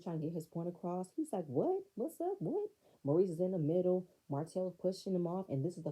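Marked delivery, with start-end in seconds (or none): 1.5-2.7 high, rising challenge; mock-combative questioning; aggressive challenge. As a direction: imitate someone squaring up, pitched up. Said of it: "Marceau being aggressive".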